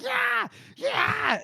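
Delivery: very high, hammed-up charging whoop; mock battle yell. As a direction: whoop it like a man storming a wall. Yolk charges up yelling.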